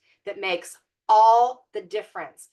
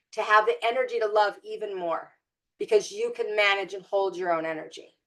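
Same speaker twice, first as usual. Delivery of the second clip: normal talking voice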